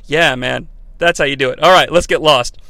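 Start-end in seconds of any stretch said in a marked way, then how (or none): none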